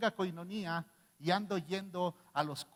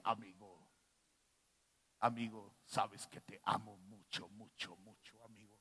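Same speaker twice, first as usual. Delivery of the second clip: imitating Cletus; 9 semitones lower, 9 dB quieter than usual. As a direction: drag out every "mucho" low and soft like a syrupy voice note. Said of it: "Le va a llegar mi imitación a Cletus".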